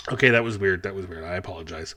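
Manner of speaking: usual speaking voice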